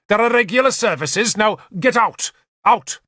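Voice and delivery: high, angry dismissal; furious snap. A narrator's reading. Bishop driving a newcomer out of church, high furious snapping. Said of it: "the bishop snapped".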